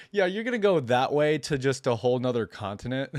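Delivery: laughing through the words; amused disbelief, laughing high; cracking up at the elder's directions. Real, not acted.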